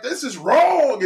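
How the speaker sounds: husband insisting to his wife; passionate protest. Retold or imitated. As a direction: lean in hard on it. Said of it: "I'll be like".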